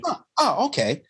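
high, startled huh then a dropped okay; replaying the exec's startled greeting, mock startled; taken-aback huh. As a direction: mimic the executive's awkward surprise. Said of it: "But he just".

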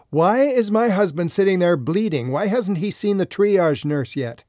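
loud, high indignant demand. Retold or imitated. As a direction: demand answers from the staff. Wife demands attention angrily.

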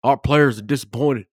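a hint of a disappointed Saban; mild Saban imitation at the press conference; Saban impression. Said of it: "in my best Joey Molnaro voice".

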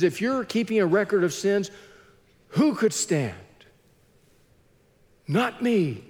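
lightly acted relief, misery loving company; a touch of rueful relief; a bit relieved. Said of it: "psalmist being a bit relieved that misery loves company".

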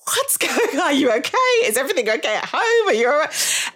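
high, worried teacher voice asking if she's okay; concerned teacher. Retold or imitated. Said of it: "And she was like".

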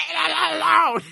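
high yipping coyote call; coyote howl voice. Retold or imitated. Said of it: "sounded like I turned into a coyote".

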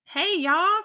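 casual greeting in a light Southern accent; a breezy, high Southern hello. Real, not acted. Guest greets the podcast hosts in a Southern accent. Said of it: "You sound so Southern".

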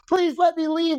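high, squeaky mock begging; mock terrified plea. Him pleading with the women to let him go, squeaky mock fear. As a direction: beg to leave in a hammed-up frightened squeak. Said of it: "And I'm like".

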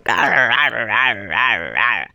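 frustrated growling. Retold or imitated. Frustrated growls throwing rocks at beach.

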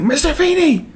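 high-pitched calling out; imitated yell. Host imitating Eric yelling for his neighbour, high holler. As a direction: holler the name up high for someone outside. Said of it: "like, yells for him at the fence".

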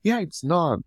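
ordinary delivery

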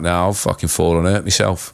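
ordinary delivery

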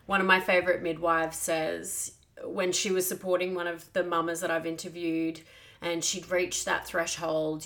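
ordinary talk

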